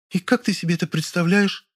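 high female voice, barely contained nerves; high-pitched with restrained eagerness; suppressed agitation. A narrator's reading. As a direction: ask high, holding back excitement. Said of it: "еле сдерживая волнение".

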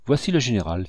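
ordinary delivery

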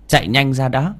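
ordinary delivery, nothing marked

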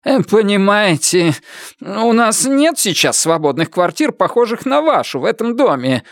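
high, hesitant excuse-making; uneasy official in a high voice; muttering agent. A narrator's reading. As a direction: mumble an awkward excuse, pitched high. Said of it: "пробормотал он".

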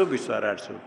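teacher softly echoing विश्वाराटसु; quiet repeat. Shown as a demonstration. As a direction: murmur विश्वाराटसु back to yourself. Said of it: "सप्तमी बहुवचन में क्या बनेगा?".

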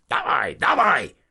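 high, loud, drawn-out soldier's shout; roared order. A narrator's reading. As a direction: roar it at him as a threat. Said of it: "ráordított Vranára". (fenyegetően, hangosan ráordítva)

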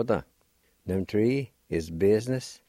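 ordinary talk

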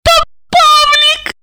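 very high, slow tearful squeal; squeaked. A narrator's reading. Olga revealing who is inside, high squeak. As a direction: squeak it out, about to sob. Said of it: "пропищала она и разрыдалась".

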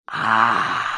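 long, breathy monster "aah"; angry hiss. A narrator's reading. Giant toad finding the friends gone, angry hiss. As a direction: draw out a spiteful hissed exhalation. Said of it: "злобно прошипело оно".

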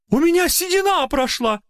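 put-on high delighted old lady cry; joyful exclaim. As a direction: burst out with joy. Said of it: "радостно воскликнула она". (радостный возглас старушки)

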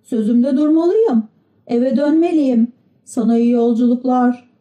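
put-on high-pitched puppet child voice; Pinokyo, dutiful. Pinokyo tells his friend he must keep his promise, dutiful high voice. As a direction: play a well-behaved little puppet, pitched high.

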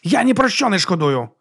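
lifted, unrepentant exclamation; exclaimed. A narrator's reading. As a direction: proclaim it with drunken defiance. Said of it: "вигукнув брат Діас".

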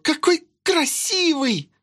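put-on high girlish squeal of delight. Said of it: "взвизгнула девчонка, не в силах сдержать восторг".

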